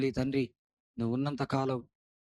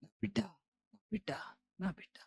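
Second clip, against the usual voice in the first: much softer, hushed loving father; father's tender cry; 7 semitones higher, 8 dB quieter than usual. Acted. Father welcoming the prodigal son, hushed tender voice. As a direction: breathe it like a father embracing his lost son.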